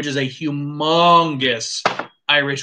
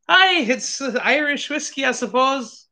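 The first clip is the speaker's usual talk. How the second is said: bad Irish accent; 8 semitones higher than usual. Taster tasting Irish whiskey slips into a joke Irish accent. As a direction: put on a cheesy Irish accent, pitched high. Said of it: "Makes me speak like the Irish, or at least a bad stereotype".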